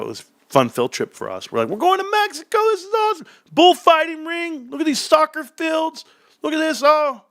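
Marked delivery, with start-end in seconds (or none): 1.7-7.2 put-on high, giddy kid pointing things out; excited kid voice. Kid to sibling on the drive, high excited chatter. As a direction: squeal like a kid on a road trip. Kids excited about Mexico trip.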